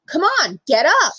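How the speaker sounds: hint of an angry bus driver; impatient order. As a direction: tell her to get up now, impatient.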